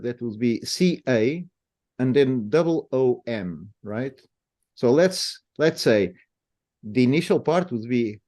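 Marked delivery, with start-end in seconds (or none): none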